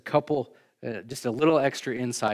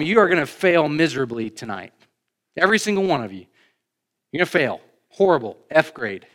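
blunt warning; 4 semitones higher, 6 dB louder than usual. Retold or imitated. Jesus paraphrased, warning disciples bluntly.

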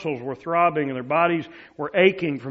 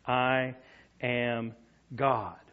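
softer, slow, each word set apart; hushed solemn voice; 4 semitones lower, 5 dB quieter, slower than usual. Retold or imitated. Preacher voicing Jesus to the congregation, hushed and solemn. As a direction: let it land in a hush.

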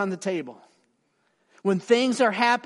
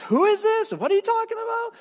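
much higher, baffled voice on the phone; confused stranger; 4 dB louder, faster than usual. Retold or imitated. Old friend on the phone, puzzled, high baffled voice. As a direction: play the puzzled man who has no idea who's calling.

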